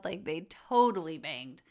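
usual speaking voice

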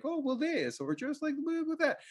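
hammed-up high nasal-free mock of a husband; mock indifferent husband. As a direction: parody his lame shrugging replies.

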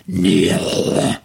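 low, drawn-out creepy order; low menacing command. Retold or imitated. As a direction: growl it like a lurking servant.

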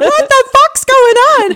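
high and slow, panicking; a scared half-scream of confusion; terrified cry. Retold or imitated. Woken by her boyfriend shouting, she panics. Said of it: "I was like".

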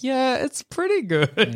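high, slow, forced approval; strained, drawn-out fake contentment; pained smile. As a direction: imitate praise through a pained smile. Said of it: "more and more pained smile".

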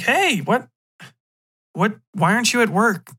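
high-voiced, questioning girlfriend; puzzled girlfriend. Girlfriend finds him home from work, puzzled.

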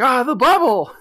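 high, loud startled cry; mock yelp. Landfill hit in the face by the bubble, yelping loudly. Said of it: "He's like".

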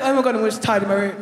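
put-on fast, breathless little-boy rush; hurried schoolboy mock tidy-up; mock excited guy. Comic mocking the date rushing upstairs, fast and giddy.

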